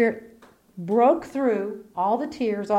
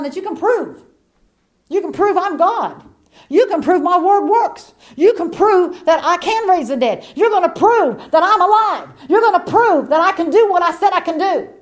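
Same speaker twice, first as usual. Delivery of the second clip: higher, louder fervent preaching build; full-force preaching; 8 semitones higher, 9 dB louder than usual. Acted. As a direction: rise into a thundering proclamation.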